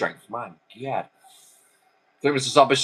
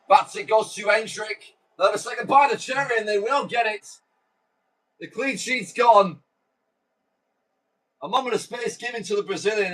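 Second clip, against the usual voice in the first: raised-pitch football commentator; play-by-play commentary; 8 semitones higher than usual. Acted. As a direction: call the goal like a live TV commentator.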